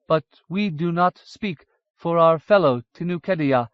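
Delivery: lightly voiced wary Dwaro; hesitant Dwaro. A narrator's reading. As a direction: hold back, careful. Ysfidri voiced.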